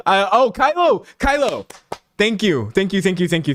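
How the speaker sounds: high burst of sudden recognition; real excited outburst; excited relief. Real, not acted. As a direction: blurt it out high, thrilled to finally have it. Suddenly gets the forgotten name.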